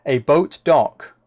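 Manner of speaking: a boat dock said slowly, each word spelled out clearly; slow right down and get a boat dock right; deliberate slow fix of a boat dock; careful correction. Real, not acted.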